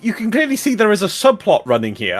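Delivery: high, rapid flustered pleading; protest, flustered and high. Real, not acted.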